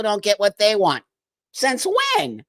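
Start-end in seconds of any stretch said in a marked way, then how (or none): 1.5-2.4 incredulous. Real, not acted. Incredulous retort.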